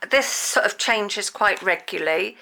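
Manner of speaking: normal talking voice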